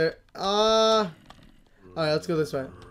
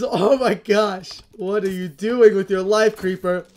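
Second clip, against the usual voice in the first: usual speaking voice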